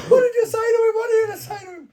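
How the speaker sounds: very high, loud wailing screech; hammed-up tearful shriek of protest; mock distraught girlfriend. Girlfriend demands answers over crying boyfriend, mock hysterical shriek.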